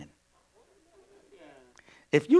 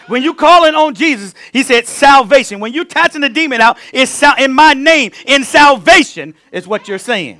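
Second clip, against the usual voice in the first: preaching at full force, higher and louder; 8 semitones higher, 10 dB louder than usual. Real, not acted. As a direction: build it up like a sermon, higher and louder. Preaching builds up.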